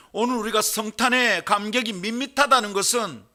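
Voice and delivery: loud, high-pitched pulpit exclamation; full-force preaching. Real, not acted. Preacher questioning how shepherds could understand it, full-force preaching. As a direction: drive each question out loudly to the congregation.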